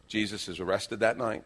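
ordinary talk, nothing marked

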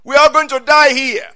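high, loud mock wail of the people; complaining people. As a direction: whine like the frightened people. Preacher voices complaining people.

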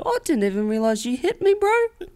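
high-pitched, imitated oblivious bloke; unbothered Kiwi, high mock voice; easygoing New Zealander. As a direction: play the forgiving local, climbing very high. Imagined New Zealander shrugging off crash.

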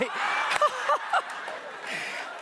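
high breathy burst of laughter; real laugh. Real, not acted. Speaker cracks up at own joke.

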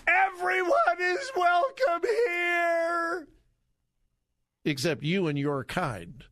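high, slow, screeching mock of the protester; mocking shrill scream. Host mocking the minister's protest in a high shriek. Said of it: "did you hear what she said".

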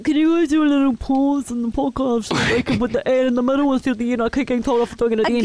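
put-on voice of a complaining producer; Carl. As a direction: mimic a whingeing producer pleading with the hosts. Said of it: "Again, uncanny".

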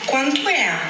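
Portuguese speaker faking a carioca accent; fake Rio accent. Shown as a demonstration. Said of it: "as pessoas no Rio de Janeiro falam assim".

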